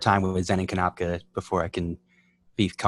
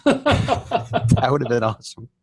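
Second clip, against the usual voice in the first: laughing; 5 semitones higher, 4 dB louder, much slower than usual. Real, not acted. Laughing at the idea.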